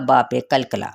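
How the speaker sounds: ordinary talk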